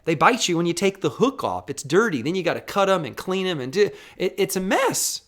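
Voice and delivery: ordinary talk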